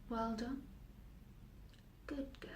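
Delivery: very soft, intimate praise; hushed inner voice. Acted. Voices in her head praise the girl, hushed. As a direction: murmur the approval very quietly.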